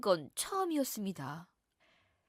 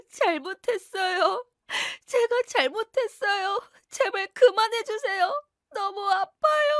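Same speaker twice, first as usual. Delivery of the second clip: high, tearful begging, much higher; crying plea; 10 semitones higher, 8 dB louder than usual. Acted. Beaten wife begging husband to stop, high tearful sobs. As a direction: sob out apologies in a high voice.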